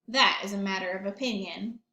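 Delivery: plain reading voice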